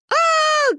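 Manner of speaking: high-pitched scream; falling scream. A narrator's reading. Mother flying toward the water, screaming. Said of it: "кричала мама, пока летела к воде".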